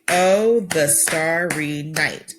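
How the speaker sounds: slow "Oh, the starry night", each syllable spaced apart. Shown as a demonstration. Teacher showing the class how to count syllables, slowly. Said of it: "maybe to clap the syllables".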